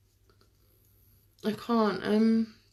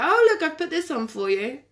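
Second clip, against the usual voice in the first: higher, lightly put-on sweet offer; playful feigned generosity; 6 semitones higher than usual. Partner pretending the programme is for him, higher feigned generosity. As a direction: imitate yourself making a show of favour. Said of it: "sometimes I'll be like".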